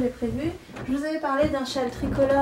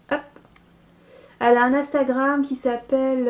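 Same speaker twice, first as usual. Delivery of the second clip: ordinary talk, nothing marked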